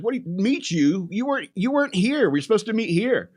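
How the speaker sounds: high-pitched, acted indignation; baffled protest. Retold baffled protest to his friends.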